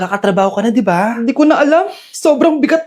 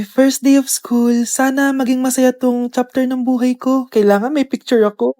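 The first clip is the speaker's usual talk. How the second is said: ordinary talk, nothing marked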